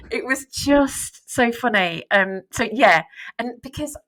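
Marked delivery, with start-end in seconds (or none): none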